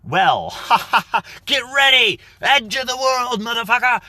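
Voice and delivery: hammed-up gleeful captain's shout; laughing into a yell. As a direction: cackle building to a very high, loud battle cry. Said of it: "you're just like".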